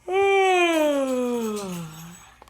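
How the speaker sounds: big, loud stretching yawn; hammed-up lion yawn. Shown as a demonstration. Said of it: "a big yawn like a lion".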